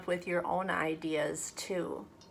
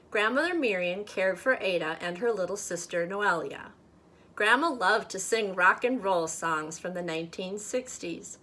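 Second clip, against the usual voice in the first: lively, louder storybook voice; story reading to children; 5 dB louder than usual. A narrator's reading. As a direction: read it aloud like a picture book for kids. Reads picture book aloud to children.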